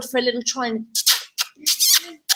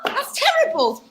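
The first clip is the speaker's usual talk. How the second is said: high mock shock on terrible; mock horror; 13 semitones higher than usual. Teacher hams up shock at the story.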